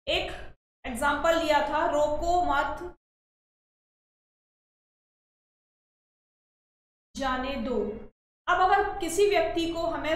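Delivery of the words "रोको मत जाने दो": रोको मत as one phrase, long break, then जाने दो; pause after मत. Shown as a demonstration. Teacher reads the example to students, pausing after मत. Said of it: "तो हम कहाँ लगाना चाहिए हमें विराम चिन्ह".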